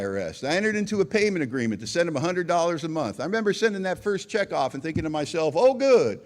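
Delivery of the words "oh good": sarcastic cheer. Retold or imitated. Sarcastic thought about huge debt.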